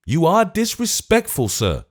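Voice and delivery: high, offended reproach; a pompous official taking offence; indignant rebuke. Acted. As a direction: Lord Mayor scolding the old gentleman. Mayor rebukes the visitor.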